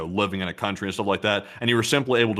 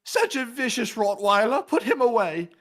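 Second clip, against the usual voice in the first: very high-pitched, slowed fussy voice; prim alarmed owner; 4 dB louder than usual. Acted. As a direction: fret over a vicious pet. Alarmed owner reacts to vicious pet.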